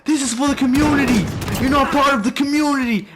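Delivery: high, loud accusing screams; angry screaming. Retold or imitated. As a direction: scream it like an outraged man making a public accusation. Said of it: "He was screaming".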